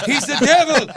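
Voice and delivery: high, loud cry in mimicked accent; [name]'s accent. Singer accusing a visitor, shrieked in mimicked accent. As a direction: shriek it in her put-on accent. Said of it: "With her accent".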